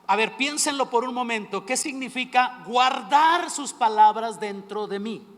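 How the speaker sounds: predicar con fuerza y más alto, alargando las frases; predicación enérgica. Acted. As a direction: exhortar a la congregación con pasión. (predicando con fervor, voz más alta y fuerte)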